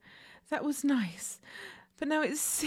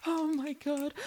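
normal talking voice

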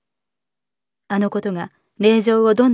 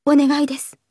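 higher, urgent little entreaty; emotional plea; 6 semitones higher, 4 dB louder than usual. A narrator's reading. Young lady imploring a man about her mother, urgent plea. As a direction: beg him earnestly. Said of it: "令嬢は早口に声を震わせた".

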